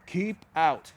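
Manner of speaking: "Keep Out" punched loud as a warning; emphatic Keep Out. A narrator's reading. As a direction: read "Keep Out" like a stern sign. Sign read out emphatically.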